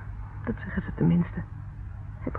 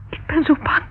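frightened; 6 semitones higher, 4 dB louder than usual. Acted. Confessing fear to John.

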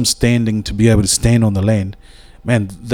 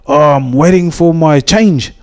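raised, drawn-out punchline voice; a lightly voiced Māori fella, slow punchline; punchline voice, higher and slow; 6 semitones higher than usual. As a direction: deliver the punchline slow and high.

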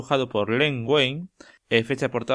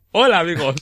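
cartoon character voice; 6 semitones higher, 9 dB louder, faster than usual. Voicing the Snapper character.

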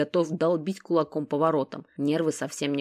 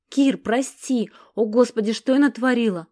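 horrified apology; 6 semitones higher, 6 dB louder than usual. A narrator's reading. Sasha horrified at hurting him.

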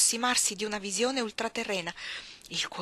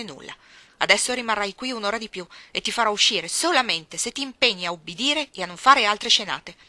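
loud, hard reprimand; cold scolding aunt; 8 dB louder than usual. Acted. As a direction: lay down the punishment to the child. La zia sgrida la bambina. (la zia sgrida la bambina, forte e severa)

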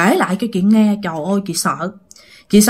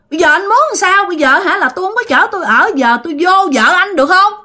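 high, insistent ghostly woman's voice; ghost woman's complaint; 7 semitones higher than usual. Acted. Ghost scolds the man for destroying her home, high aggrieved voice. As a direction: press the grievance in a raised, high pitch. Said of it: "bà nói rằng á hả".

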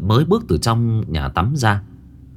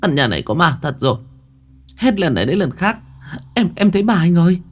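stammering, climbing much higher and faster; terrified; 7 semitones higher than usual. A narrator's reading. Wife clinging to husband after seeing a ghost, panicked stammer. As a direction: stutter in panic, pitch shooting up. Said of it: "Thảo vội vàng ôm lấy chồng".

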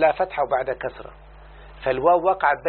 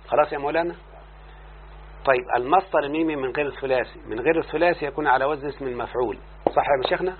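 normal talking voice

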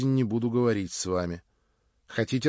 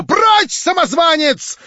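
much higher, loud imperious shout; commanding cry; 14 dB louder, slower than usual. A narrator's reading. Marya drives off the impostor, imperious shout. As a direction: order him out like a queen. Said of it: "повелительно вскричала она".

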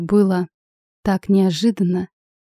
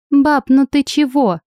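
slow, pouting high protest; скривившись; 6 semitones higher, 4 dB louder than usual. Acted. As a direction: whine it with a grimace. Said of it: "Сашка скривилась". (с недовольной гримасой)

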